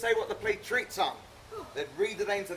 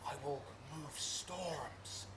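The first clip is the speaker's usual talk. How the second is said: grand tragic actor; 6 semitones lower, 17 dB quieter, much slower than usual. Acted. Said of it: "let the audience look to their eyes".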